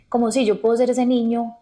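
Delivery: ordinary talk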